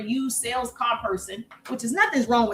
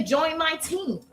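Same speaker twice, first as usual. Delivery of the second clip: mock pitch; 3 semitones higher, slower than usual. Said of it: "Don't say".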